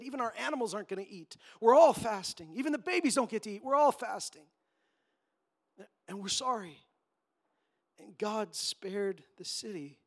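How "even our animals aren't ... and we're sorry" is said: fast, loud, desperate pleading; frantic pleading. Retold or imitated. The people of Nineveh crying out to God, frantic and loud. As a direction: beg for mercy in a rush. Repentant Ninevites voiced in a retelling.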